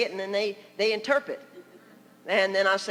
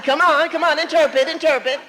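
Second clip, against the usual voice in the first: loud, higher-pitched prodding; mock-exasperated, raised-voice coaxing; insistent urging; 5 semitones higher, 9 dB louder than usual. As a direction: urge a frozen partner on. Retold urging of a frozen interpreter.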